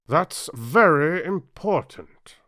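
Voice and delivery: slow, loud and high pompous pronouncement; king solemnly addressing the jury. Acted. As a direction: lay it out grandly, word by word.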